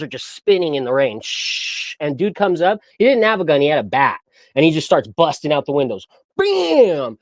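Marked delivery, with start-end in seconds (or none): 1.2-1.9 soft hissing shush of spinning tires; spinning-wheel noise. Retold or imitated. Storyteller imitating wheels spinning in the rain, hissed. 6.4-7.2 high-pitched imitated crash; smashing sound effect. Storyteller imitating windows being busted out, explosive crash noise.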